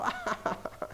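soft, airy mock chuckle; imitated parent's laugh. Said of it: "seen the parents just, like, laugh".

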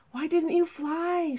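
high, urgent question; distressed cry. Acted. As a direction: cry it out, pitched well up. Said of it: "she cried".

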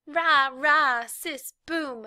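high, chanted cheer, starting loud; mock cheerleader. A narrator's reading. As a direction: mimic a cheerleader's chant mockingly. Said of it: "the way girls do".

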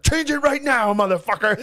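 high, loud angry demand; mock-enraged high shout; imitated furious dad. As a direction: explode like a dad at his wits' end.

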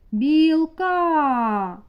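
"Білка" with a long, loud final А; виділений останній звук. Shown as a demonstration. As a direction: stretch the last А of "Білка". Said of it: "останній звук в слові". (протяжне, гучне А в кінці слова "Білка")

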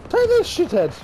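high mock imitation of the taunt; mocking game taunt. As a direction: parrot the game line back, hammed up. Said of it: "That's nice".

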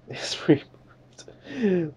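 slow mock whisper. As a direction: whisper "It's pre-approved" very slowly, drawn out. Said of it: "this is hilarious".

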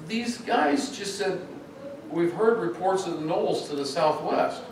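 the boss retelling the reports, lightly voiced. Boss voiced in retold story.

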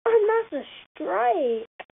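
slow, high, stretched-out announcer call; milk every word like a sportscaster; drawn-out strike call. Real, not acted. Player announcing his strike to viewers, slow high announcer style.

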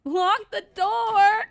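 high, loud, slow, crying out; panicked scream. Real, not acted. Streamer begging the game to lock the door, screaming. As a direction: scream it in terror.

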